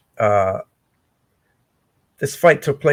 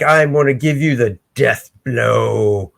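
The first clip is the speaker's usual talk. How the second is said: menacing villain; 4 semitones lower, slower than usual. Retold or imitated. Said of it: "He's like".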